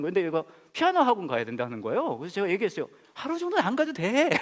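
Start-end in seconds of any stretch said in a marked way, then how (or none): 3.1-4.3 high mock-persuasive coaxing; playful coaxing. Pastor coaxing girl to skip piano, high playful voice. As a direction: tempt the kid to skip piano.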